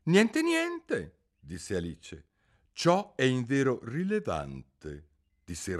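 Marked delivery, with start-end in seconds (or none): 0.0-1.2 much higher, firm repeat; a child standing her ground; Alice repeating herself to the king; Alice, girlish. A narrator's reading. Alice answers again. 2.7-5.1 slow, self-important verdict; king addressing the jury gravely; pompous king. A narrator's reading.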